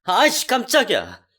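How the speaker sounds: 놀라서 버럭 소리침; startled shout. Retold or imitated. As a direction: yelp of shock, caught off guard. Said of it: "저에게 소리치더라고요". (깜짝 놀라 소리치는 남편)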